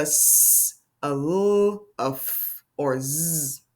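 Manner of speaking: "s", "l", "f", "z" as drawn-out sounds; letter sounds. Shown as a demonstration. Teacher checking how the word ends, letter sounds held out. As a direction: hold each sound "s", "l", "f", "z", not letter names. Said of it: "did it end with".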